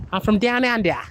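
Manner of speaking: high-pitched Aussie twang; twangy Australian accent. Shown as a demonstration. Streamer making a last attempt for viewers, Australian accent. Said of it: "the accent is so twangy".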